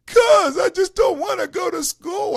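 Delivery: loud, high mock wail; imitating a kid's tearful outburst; crying six-year-old. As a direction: protest like a bawling child. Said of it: "He's crying".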